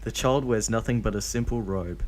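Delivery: ordinary delivery, nothing marked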